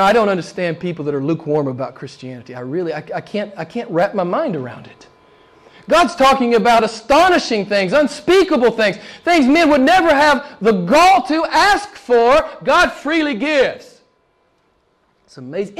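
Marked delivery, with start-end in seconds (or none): 5.9-14.0 higher, driving sermon delivery; impassioned preaching. Real, not acted. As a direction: preach it, lifting toward each amen. Preacher marvels at God's gifts.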